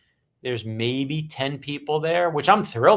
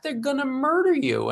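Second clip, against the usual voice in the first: imitating a panicked publicist, pitched much higher; alarmed warning; 12 semitones higher than usual. Publicist warns the author about the readers, mock panicked high warning. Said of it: "And she's like".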